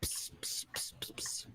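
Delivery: sharp whispered hisses; hissing noises. Acted. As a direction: hiss like a snake. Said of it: "I'm gonna make some more hissy noises".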